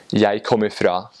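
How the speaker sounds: full Eastern Norwegian forms, "kommer" in two syllables; Eastern Norwegian dialect. Shown as a demonstration. Giving an example to compare dialects, Eastern Norwegian dialect. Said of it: "that is the Eastern dialect".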